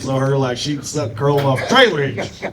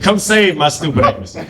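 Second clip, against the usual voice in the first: ordinary delivery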